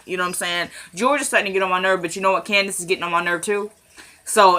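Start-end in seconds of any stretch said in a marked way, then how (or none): none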